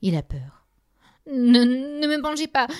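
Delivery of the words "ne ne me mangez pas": supplication aiguë et apeurée; implorer, très haut, terrifié; suppliant. A narrator's reading. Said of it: "supplie-t-il au géant". (supplique apeurée)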